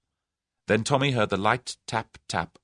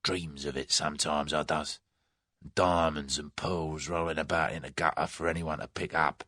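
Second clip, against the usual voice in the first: softer, a dreamy Cockney gloat; grim relish; 3 semitones lower, 6 dB quieter than usual. A narrator's reading. Hired thug describing his dream of looting, with quiet grim relish. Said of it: "He spoke with a grim relish".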